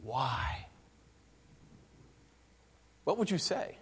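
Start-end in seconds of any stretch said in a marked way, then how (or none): 0.0-0.7 quiet weighty question; preacher voicing God asking why; God's voice. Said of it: "he says one word".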